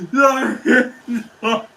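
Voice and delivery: words collapse into loud laughter; bursting into laughter. Real, not acted. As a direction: crack up helplessly mid-sentence. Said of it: "I need to stop laughing".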